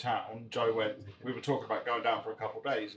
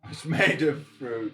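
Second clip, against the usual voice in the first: slow, louder teasing echo; mock echo; 3 semitones higher, 9 dB louder, much slower than usual. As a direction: drag it out to tease. Echoing her drink claim mockingly.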